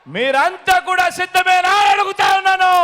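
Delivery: high, shouted challenge to a crowd; ringing shout with long-held endings; rousing rally call. Real, not acted. Asks the crowd if ready.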